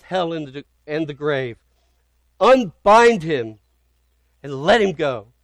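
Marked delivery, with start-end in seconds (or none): 2.4-5.3 proclaimed command. Acted. Preacher voicing God's command to death, booming proclamation. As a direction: declare it over death with full authority.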